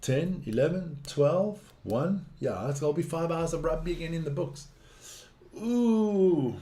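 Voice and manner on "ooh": high, drawn-out ooh, falling away; sustained wincing reaction; long falling spectator's ooh. Real, not acted. Reacts while watching the game.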